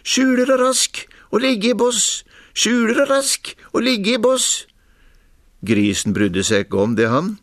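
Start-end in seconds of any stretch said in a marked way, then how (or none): 0.1-4.7 high, squeaky fox chant; thin, shrill piping. A narrator's reading. Fox in the bushes teasing the pig, piping shrilly. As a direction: pipe it up high and fine, taunting. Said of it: "pipe fint og gnelt".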